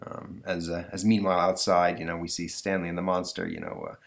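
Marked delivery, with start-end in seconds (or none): none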